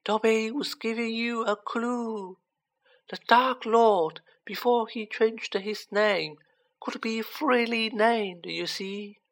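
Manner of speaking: high, slow elf voice; earnest elf. A narrator's reading. Dobby explaining the clue to Harry, earnest and high. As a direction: explain it high and slowly, as if obvious.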